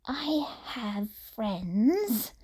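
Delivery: defensive. A narrator's reading. Tomura defends himself to the boy, slow and defensive. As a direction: insist on it, a touch wounded. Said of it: "Tomura defended immediately".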